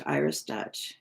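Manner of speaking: ordinary talk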